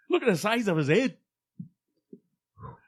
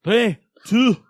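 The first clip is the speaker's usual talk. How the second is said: loud, higher countdown in a put-on Brett voice; pitched-up countdown in a mock Brett voice; 6 semitones higher, 9 dB louder than usual. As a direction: bark the numbers like Brett. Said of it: "in your Brett voice".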